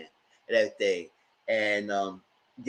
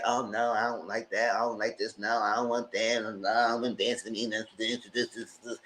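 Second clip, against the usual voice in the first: a touch of a fussy prude, lower and softer; prissy mock voice; 3 semitones lower, 6 dB quieter than usual. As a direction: lightly mimic her picky refusals. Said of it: "she was very like".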